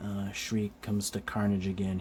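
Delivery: usual speaking voice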